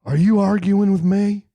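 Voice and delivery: mock confrontational. Mock boss challenging an underling.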